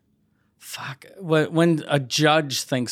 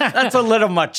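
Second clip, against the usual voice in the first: higher, louder prim objection; prim judge voice; 7 semitones higher, 3 dB louder than usual. Pretend. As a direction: object primly, pitched up like a judge. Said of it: "That's not the same".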